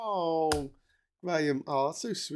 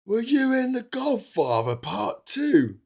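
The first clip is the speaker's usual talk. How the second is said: cheeky heckler; 9 semitones higher, 5 dB louder, slower than usual. Retold or imitated. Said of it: "Robert De Niro's walking down the street".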